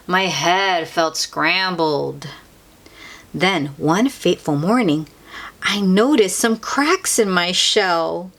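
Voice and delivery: ordinary delivery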